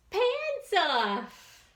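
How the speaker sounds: high, loud punchline; mama's voice. Acted. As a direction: finish high like a scolding mom. Mama scolding.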